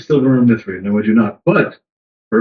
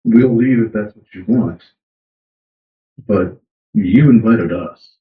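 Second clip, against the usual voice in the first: slow in-character line; 2 semitones lower, much slower than usual. Acted. Player speaks in Old Felorian.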